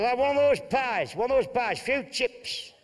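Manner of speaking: gruff Bolton husband. Acted. Telling Martha he won't eat Spanish food. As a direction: bluster like a fussy holidaymaker.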